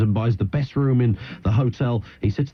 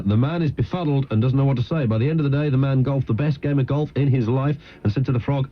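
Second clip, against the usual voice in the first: ordinary talk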